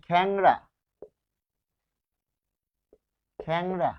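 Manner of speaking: খ্যাংড়া said "khæng-ra", open first vowel; e becomes æ. Shown as a demonstration. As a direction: model খ্যাংড়া clearly with æ before the ng. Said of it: "এ হয়ে যাচ্ছে".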